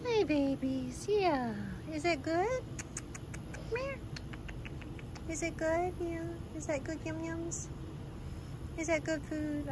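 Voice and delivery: high, soft coaxing coo to shy cats. Real, not acted. Woman coaxing scared gas station cats, soft high coo. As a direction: sweet-talk the stray cats softly.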